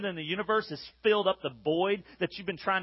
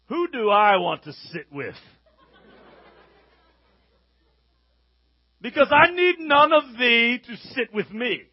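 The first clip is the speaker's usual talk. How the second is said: slow, lofty, laughing royal tone; mock haughty grandeur; 4 semitones higher, 7 dB louder than usual. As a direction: play the lordly student holding court, slowly. Mock grand student in cafeteria.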